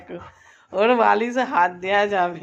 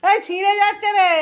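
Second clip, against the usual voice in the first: alarmed cry; 8 semitones higher than usual. Real, not acted. Pet tearing the pillow.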